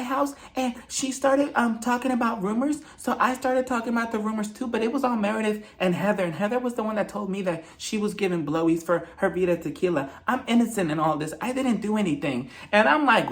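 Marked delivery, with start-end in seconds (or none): none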